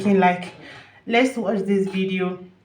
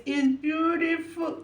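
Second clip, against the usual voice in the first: higher, softer and slow, full of feeling; moved and tender; 9 semitones higher, 4 dB quieter, slower than usual. Real, not acted. Vlogger repeating praise for the performance, slow and tender. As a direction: let "It's beautiful" melt out slowly. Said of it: "sorry for being emotional".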